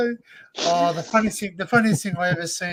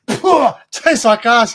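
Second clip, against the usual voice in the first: slow, louder comic disgust; 4 semitones higher, 6 dB louder, much slower than usual. Acted. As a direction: blurt it out as the revolted customer in a joke. Acts disgusted customer in joke.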